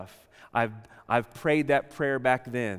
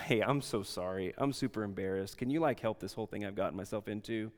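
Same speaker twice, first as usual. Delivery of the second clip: softer, lower imagined apology; sheepish apology; 5 semitones lower, 8 dB quieter than usual. Retold or imitated. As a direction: say it like a mildly embarrassed apology. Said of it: "they would have gone".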